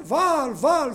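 loud high cry of Baal's prophets; shouting to Baal. Retold or imitated. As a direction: call out the idol's name at full voice. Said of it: "φωνάζανε".

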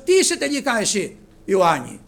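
loud, high rhetorical questioning; preaching at full force. Acted. Preacher challenging the congregation, loud preaching. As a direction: call out the question to the crowd.

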